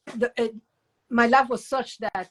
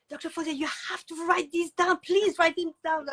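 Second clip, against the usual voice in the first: imitating pleading kids; 9 semitones higher than usual. Kids urging her to write.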